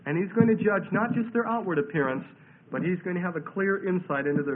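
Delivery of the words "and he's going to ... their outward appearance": normal talking voice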